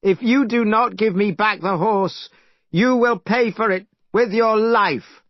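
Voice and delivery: threat. Acted. Monkey threatens dragon.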